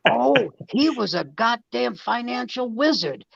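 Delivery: hammed-up furious wife tearing into her husband; mother exploding. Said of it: "one night she exploded".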